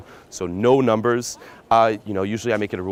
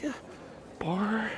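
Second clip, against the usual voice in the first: high, soft, unenthusiastic mock suggestion; no fun vibe; 12 semitones higher, 11 dB quieter than usual. Shown as a demonstration. Pickup coach mimicking a dud bar invitation, no fun. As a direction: imitate a limp, timid invitation. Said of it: "there was not, like, a really".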